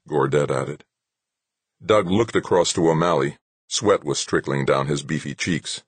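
plain reading voice

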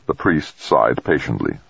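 usual speaking voice

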